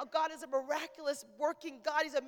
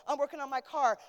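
ordinary talk

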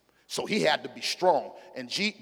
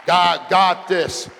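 loud slow repeat; 3 semitones higher, 10 dB louder, much slower than usual. Shown as a demonstration. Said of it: "a fourth time".

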